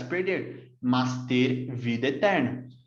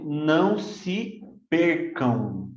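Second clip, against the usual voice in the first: "não se percam" drawn out word by word; very slow; much slower than usual. Shown as a demonstration. Teacher reading the translation to students very slowly. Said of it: "nós traduzimos isso aqui como".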